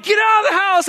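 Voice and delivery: high-pitched frantic shout; panicked yell. Acted. Husband yelling to his wife about a snake, high panicked yell. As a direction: yell it high, acting out the alarm. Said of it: "I yelled to my wife".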